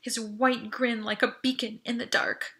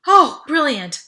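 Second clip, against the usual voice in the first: loud, relieved burst of breath; relieved gust; 6 semitones higher, 13 dB louder, slower than usual. Acted. To Audrey, a loud relieved exclamation. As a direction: blurt it out in relief. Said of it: "with a gust of breath".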